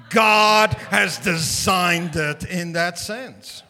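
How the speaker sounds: booming pulpit shout; full-force preaching. Real, not acted. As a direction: drive it home to the crowd.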